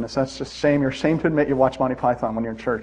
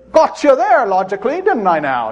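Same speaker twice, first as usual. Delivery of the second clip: higher, louder teasing gotcha; smug gloat; 6 semitones higher, 3 dB louder than usual. Retold or imitated. Preacher teases his wife when winning, smug and louder. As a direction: needle your wife with a smug grin. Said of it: "I'll say".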